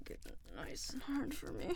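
hushed seductive whisper. Acted. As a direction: breathe the words close to the ear. Whispered intimate command.

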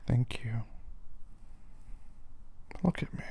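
whispered thanks; soft unvoiced thank you. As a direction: breathe it, relieved. Partner finally nods.

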